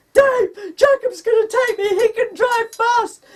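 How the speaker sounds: high, loud, crying call; panicked calling out. Retold or imitated. Wife calling to husband that neighbour will drive, crying shout. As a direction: shout across the yard in panic.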